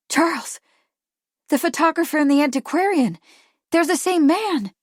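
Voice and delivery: high, loud, excited exclamation; startled realisation. A narrator's reading. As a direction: blurt out the discovery, pitch way up. Kate's sudden startled realisation.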